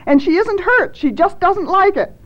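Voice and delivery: very high, loud protest; panicked shout. A narrator's reading. Thad defends himself as the trapped one wails, panicked shout. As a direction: shout your excuse in a frantic pitch. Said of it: "he shouted as another wail rose".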